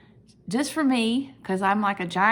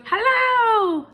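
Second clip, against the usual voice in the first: much higher, louder cheery hello; friendly letter Y; 8 dB louder than usual. Acted. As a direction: greet like a waving cartoon letter. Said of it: "he puts an arm out and he goes".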